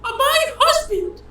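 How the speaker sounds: very high falsetto shushing; angry wife. Acted. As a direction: silence them as an outraged wife. Wife silences interruption, accuses husband.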